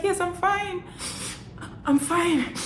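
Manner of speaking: high-pitched, put-on brave voice; mock tearful reassurance. Teary student reassuring worried labmates, high mock-brave voice. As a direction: insist on it in a high little voice.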